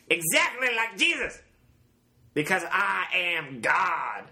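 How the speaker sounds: high, ringing prophet of resurrection; Charles Manson impression. Finishing the suicide note for a co-host, high Manson impression. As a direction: preach it high, like a self-made messiah. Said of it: "I was losing my impression as it was going".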